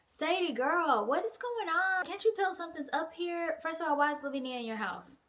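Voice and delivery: high-pitched mock scolding of a friend; exasperated scolding. Reader scolding the book's heroine.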